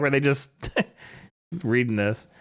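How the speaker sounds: ordinary delivery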